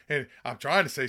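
normal talking voice

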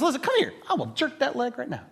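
mock stern lord giving orders; a lightly voiced commanding lord; stern master. Lord ordering his servant to take the pain, lightly voiced commanding master.

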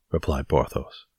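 plain reading voice

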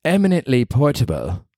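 light imitation of a British accent; a hint of posh English mother. Quoting Kate Beckinsale about her baby, light British accent. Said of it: "And she goes".

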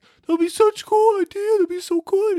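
high, starry-eyed gushing; high dreamy excitement. Retold or imitated. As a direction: gush like it's a brilliant idea. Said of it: "it's like".

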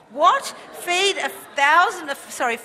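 high, loud questioning of the order; loud incredulous outburst. Retold or imitated. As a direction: cry out in disbelief as the insolent servant. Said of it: "spoke out too loudly".

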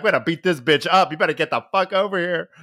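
lightly voiced reality-show brawler calling in backup; hammed-up brawler. Acting out Grace summoning friends.

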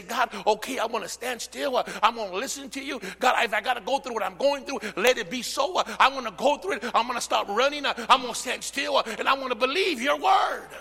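prayer building from plain to a loud high shout; a believer surrendering to God, rising to a shout. Acted. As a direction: pray it out, climbing to a cry.

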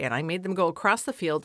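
normal talking voice

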